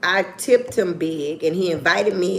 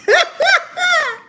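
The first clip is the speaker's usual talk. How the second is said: loud squealing laugh; big real laugh; loud high laugh; 6 dB louder than usual. Real, not acted.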